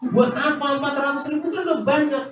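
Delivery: hammed-up furious dad; angry father. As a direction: scold the child fast and high, exasperated. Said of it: "Marah-marah papanya".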